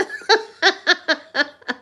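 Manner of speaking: long, high real laughing fit. Real, not acted. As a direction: burst out laughing at your own confession. Laughs at her own confession.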